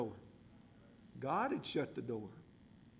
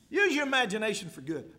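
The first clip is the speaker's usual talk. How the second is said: emphatic repeat; 5 semitones higher, 6 dB louder than usual. Shown as a demonstration.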